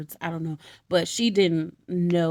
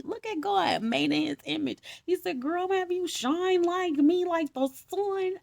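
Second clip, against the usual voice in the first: playful God voice; 9 semitones higher than usual. Pretend. God quoted in playful high voice.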